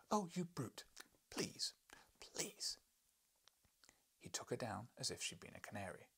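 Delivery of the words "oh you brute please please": high, sulky pleading; wife's high plea. Acted. Wife to husband, a high sulky plea. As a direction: beg him, sulking.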